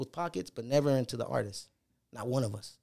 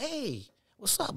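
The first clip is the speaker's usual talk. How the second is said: higher, slow and soft casual greeting; imitating the caller; 8 semitones higher, 6 dB quieter, slower than usual. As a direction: drawl it like a laid-back caller. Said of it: "They call me from Canada. Like".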